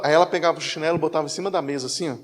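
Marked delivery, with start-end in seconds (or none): none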